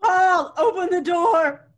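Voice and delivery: loud, high plea at the door; urgent calling. Acted. Wife shouting through door to husband, urgent. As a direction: beg to be let in.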